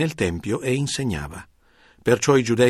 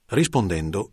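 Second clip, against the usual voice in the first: ordinary delivery, nothing marked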